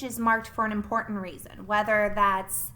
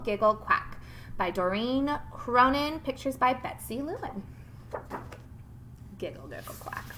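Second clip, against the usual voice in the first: ordinary talk, nothing marked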